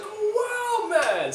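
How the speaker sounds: high, drawn-out mock-amazed "wow"; retold amazed greeting. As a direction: re-enact being happily astonished, pitch soaring. Retells his surprised greeting to friend.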